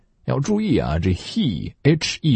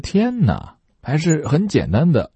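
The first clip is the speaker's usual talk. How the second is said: high playful "tianna"; 夸张惊叹; mock amazement; 8 semitones higher than usual. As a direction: gush in mock wonder.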